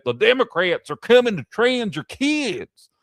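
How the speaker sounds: mocking them in a put-on Southern drawl. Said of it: "I used to throw on a Southern drawl".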